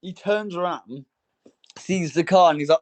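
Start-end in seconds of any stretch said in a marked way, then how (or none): none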